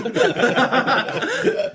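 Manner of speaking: squealing laugh; high burst of laughter. Real, not acted. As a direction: break into a shrill laugh. Joke about gloves as condoms.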